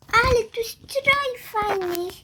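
high, slow wide-eyed admiration; admiring. Acted. Mia arrives at the costume ball, high drawn-out admiration. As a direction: draw it out high in wonder.

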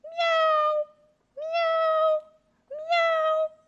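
high, loud meowing; kitten voice. A narrator's reading. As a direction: meow like a kitten. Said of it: "le chat miaule aussi fort qu'il peut".

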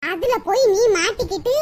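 ordinary talk, nothing marked

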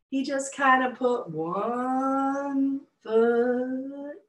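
slow, stretched storytelling; slow drawn out. A narrator's reading. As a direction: drag every word like a turtle's step. Narrator stretches words for slowness.

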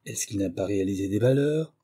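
usual speaking voice

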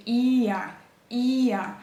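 «іа» with the І stretched and stressed; drawn-out І. Shown as a demonstration. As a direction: draw out the І of «іа», drop briefly onto А. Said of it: "коли ми будемо протягувати ось це".

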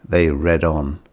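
ordinary delivery

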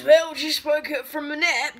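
playful mock Australian vowels; put-on Australian accent. Vlogger telling viewers about waking up, put-on Australian accent. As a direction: say it like a joke Australian. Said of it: "Australian accent".

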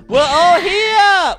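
delighted. Acted. Gasp, excited counting done.

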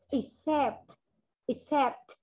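EXCEPT with audible P and T at the end; clear final sounds. Shown as a demonstration. Teacher shows class clear endings, EXCEPT with released final T. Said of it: "có âm đuôi là rõ ràng luôn".

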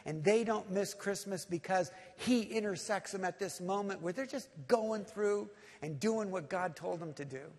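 ordinary delivery

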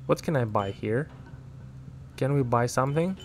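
ordinary talk

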